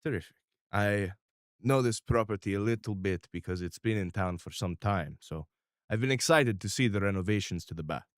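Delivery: lightly voiced calm mob boss; Mister R, measured. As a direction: speak like a calm, controlling patriarch. GM voicing Mister R.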